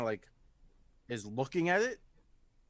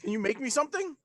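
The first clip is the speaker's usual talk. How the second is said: much higher voice, asking; eager character request; 12 semitones higher, faster than usual. Acted. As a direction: ask in her high little voice. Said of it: "And it's like".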